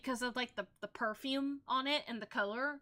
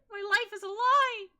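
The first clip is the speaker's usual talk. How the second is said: mock despair; 9 semitones higher, 6 dB louder than usual. Mock dismay at revelation.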